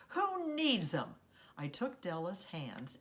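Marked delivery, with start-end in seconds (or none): 0.1-1.2 high, loud, drawn-out defiant yell. A narrator's reading. Carrie jumps up for lonely Della, yelling out. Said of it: "I jumped up and yelled out".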